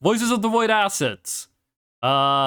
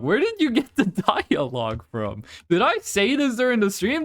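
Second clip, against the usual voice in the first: ordinary delivery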